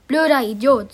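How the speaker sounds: lautes, sauer geschimpftes Blöder Idiot; wütend. Acted. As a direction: beschimpf ihn wütend. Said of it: "so sauer hatte die Klasse". (Annalena beschimpft Jannik laut)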